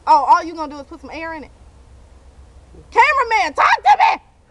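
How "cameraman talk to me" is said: high loud demand; much higher, louder shout. Real, not acted. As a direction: yell at the cameraman for an answer. Demanding an answer from cameraman.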